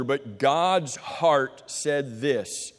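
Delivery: normal talking voice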